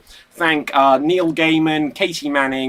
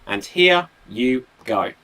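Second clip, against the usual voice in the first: ordinary talk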